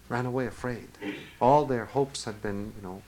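ordinary delivery

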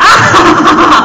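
high, loud triumphant cackle; acted shouted laughter. A narrator's reading. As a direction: burst into a hammed-up villain laugh. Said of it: "he finished loudly".

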